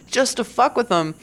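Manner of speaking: normal talking voice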